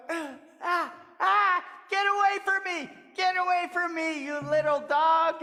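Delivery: high, loud fussy tree voice; shooing tree. Acted. The tree pushes Coyote away, high and loud. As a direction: shoo him off, high and sharp.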